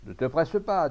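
loud, high onlooker's advice; calling out. A narrator's reading. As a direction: call to the shooter from the crowd. Onlooker calls advice to shooter.

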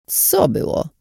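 hoarse breathless croak; rasped. Acted. Dazed old woman answers the girl, hoarse croak. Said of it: "wycharczała".

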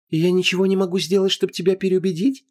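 high, flirty pleading voice; coquettish goddess. Acted. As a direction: flutter it up high. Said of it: "захлопала длиннющими ресницами Инанна".

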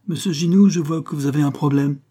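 an inspector greeting the foreman loudly and firmly. Acted. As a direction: greet him loudly, with authority. Commissaire greets foreman sternly.

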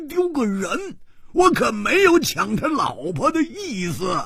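very high, strained yelling that peters out; frantic shouting. Acted. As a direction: protest at the top of the register, then lose the thread. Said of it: "大叫着说". (跳起来大叫着辩解)

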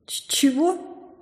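high, slow, stammered gasp; nearly choking on it; shocked. A narrator's reading. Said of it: "Чуть не подавилась я". (поражённо, с запинкой)

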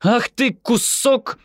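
high, loud outburst of abuse; angry shout. Acted. Yoni curses Amihai as he leaves, angry shout. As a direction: hurl the insult at full pitch. Insult shouted at departing bus.

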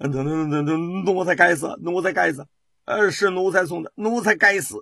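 stuttering, pleading terrified eunuch; trembling stammer. A narrator's reading. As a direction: quaver out the confession in fear. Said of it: "那声音哆里哆嗦的". (胖太监哆哆嗦嗦地认罪求饶)

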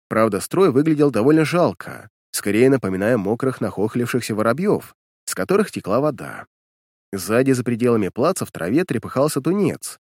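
usual speaking voice

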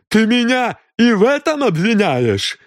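high, loud outraged question; indignant shriek. A narrator's reading. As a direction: shriek in disbelief at the charge. Mrs Creel accused of murder. (возмущённый визгливый вопрос)

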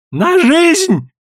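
very high, thin shout; thin shrill cry. A narrator's reading. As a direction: squeal out the ultimate stake. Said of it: "тонко крикнул он".

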